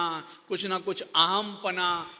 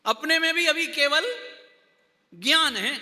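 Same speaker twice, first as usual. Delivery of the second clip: higher pitch, gap between केवल and ज्ञान है; pause after केवल; 6 semitones higher, 4 dB louder than usual. Shown as a demonstration. Teacher applying it to ourselves, pause after केवल. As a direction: stop after केवल, then deliver ज्ञान है high. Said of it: "थोड़ा सा gap डाल दो".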